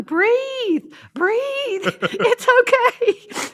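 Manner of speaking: put-on very high, slow child voice; high imitated children soothing; comforting little kids. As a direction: coach someone to breathe like a small child. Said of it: "they're trying to comfort me".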